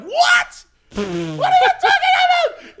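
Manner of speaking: very high, squealing indignant shout; mock outrage. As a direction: shriek it in comic disbelief. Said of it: "I was so mad".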